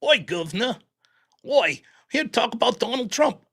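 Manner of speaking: bad mock Cockney, half Aussie; lightly done British accent, Cockney and Aussie mixed; ridiculous Cockney accent. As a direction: ham up a silly London accent. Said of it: "like, a ridiculous accent".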